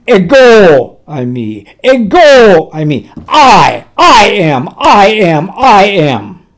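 Ego punched loud, building to a shouted I am; ringing declaration. Acted. Preacher building Jesus' declaration.

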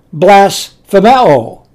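blasphemeo said slowly, syllable by syllable, as Greek; Greek pronunciation. Shown as a demonstration. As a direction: spell out "blasphemeo" carefully in Greek: blas-phe-me-o. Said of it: "I'll pronounce it".

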